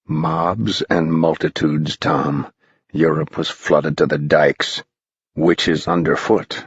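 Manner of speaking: put-on low, sly old spirit; a spooky guide teasing boys; low, strange older voice; eerie old man. A narrator's reading.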